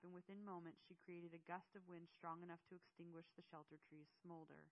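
ordinary delivery

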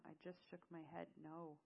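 ordinary delivery, nothing marked

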